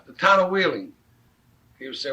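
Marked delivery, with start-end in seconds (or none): 0.0-0.9 loud, raised call to a toll attendant. Retold or imitated. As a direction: shout the town name for directions. Calling out to toll booth guy.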